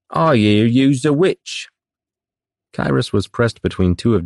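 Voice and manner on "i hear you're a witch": slow, high jeering sailor voice; rough sailor taunt. Acted. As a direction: taunt him like a rowdy deckhand. Rowdy sailor taunting Kyrus.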